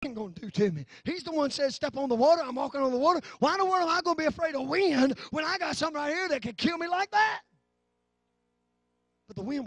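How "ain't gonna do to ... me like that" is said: rising, rolling sermon delivery; impassioned preaching. Acted. Preacher voicing Peter unafraid of the wind, impassioned preaching. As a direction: build in pitch toward an indignant question.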